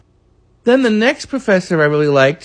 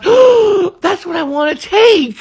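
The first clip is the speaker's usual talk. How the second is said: excited gasp; 11 semitones higher, slower than usual. Retold or imitated. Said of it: "I was, gasps".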